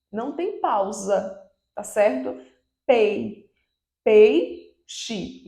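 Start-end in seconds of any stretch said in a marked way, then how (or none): 2.8-5.4 "Pei" alone, then "peixe" louder and whole; syllable-by-syllable demo. Shown as a demonstration. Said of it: "eu tenho duas sílabas".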